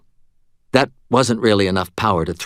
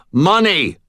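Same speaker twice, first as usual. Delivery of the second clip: loud, higher barked demand; shouted; 9 semitones higher, 5 dB louder than usual. A narrator's reading. Robber shouting at the fumbling cashier. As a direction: snap out the one word. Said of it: "I shouted".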